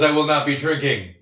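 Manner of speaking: teasing echo, breaking into laughter; mock quoting. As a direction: playfully parrot a friend's statement. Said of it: "'cause I thought it was".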